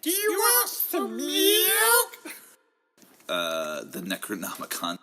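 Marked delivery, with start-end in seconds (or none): 0.0-2.4 very high, very slow cartoon squeak; slow falsetto baby-talk voice; high squeaky mock voice. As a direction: stretch it out in a tiny squeak.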